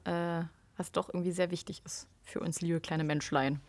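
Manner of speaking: soft whispered aside; whispering. Real, not acted. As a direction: whisper it tenderly. Affectionate aside to listeners.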